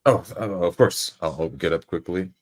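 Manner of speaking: normal talking voice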